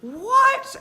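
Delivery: high shouted "What?", hammed-up; mock shout. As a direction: throw out an outraged "What?". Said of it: "charging me and saying".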